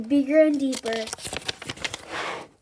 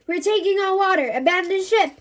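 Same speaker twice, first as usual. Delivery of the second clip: loud, urgent, higher shout; yelling; 6 semitones higher, 6 dB louder than usual. A narrator's reading. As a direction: call out the alarm to the crew. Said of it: "yells the chief".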